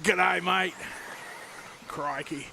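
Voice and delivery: mock Aussie greeting; Australian accent. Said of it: "gonna be an Australian citizen".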